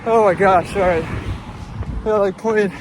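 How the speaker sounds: gasping after a hard run; winded, words pushed out loud; out of breath. Real, not acted. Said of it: "Oh, I'm tired".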